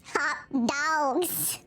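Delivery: ordinary talk, nothing marked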